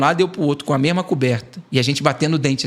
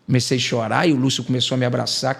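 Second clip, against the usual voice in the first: normal talking voice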